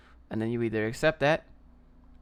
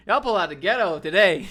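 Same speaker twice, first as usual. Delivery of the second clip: higher and louder, laughing through it; laughing call-out; 5 semitones higher, 7 dB louder than usual. Real, not acted. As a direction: throw it out loud as a teasing jab. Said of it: "repeats it louder, laughing".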